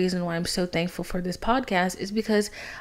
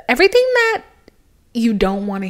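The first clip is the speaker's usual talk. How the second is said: mock horror cry; 3 semitones higher, 8 dB louder, faster than usual. Dramatic dread about construction news.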